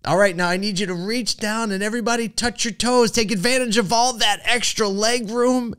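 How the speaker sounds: high, playful intercom voice, lightly imitated; teasing flight attendant. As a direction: sell the leg-room joke with a bright, high lilt. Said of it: "She says".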